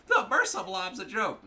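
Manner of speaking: high, loud, drawn-out sneer; mock whiny opponent. Player quoting rival before disagreeing, high mocking whine. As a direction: parrot the rival, high and stretched.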